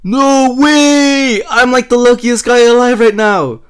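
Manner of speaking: loud, high-pitched exclaiming; thrilled, astonished yell; elated disbelief. Real, not acted. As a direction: shout in amazement at a lucky escape. Amazed to survive the explosion.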